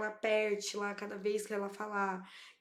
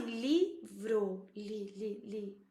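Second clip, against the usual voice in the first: syllable by syllable; much slower than usual. Shown as a demonstration. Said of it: "pensar assim".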